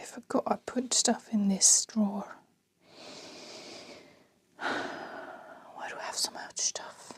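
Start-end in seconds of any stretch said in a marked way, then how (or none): 4.6-7.1 breathy unvoiced whisper to herself. Real, not acted. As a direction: whisper so the next room can't hear. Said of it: "I don't know why I'm whispering so much".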